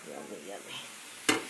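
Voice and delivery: slow, low savoring murmur; drawn-out deep yummy. Real, not acted. Tasting the finished eggs.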